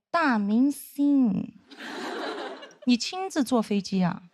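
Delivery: very slow, drawn-out mock flattery; snide, sarcastic. Senior greeting former junior on plane, slow snide drawl. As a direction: stretch out each word to needle them. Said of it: "非常阴阳说".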